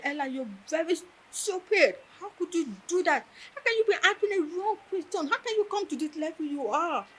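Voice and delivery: a retold furious teacher berating a student; fuming lecturer. Lecturer scolds Ella for answering the wrong question, retold fuming. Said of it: "You were fuming".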